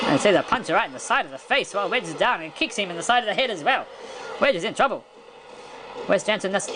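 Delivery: normal talking voice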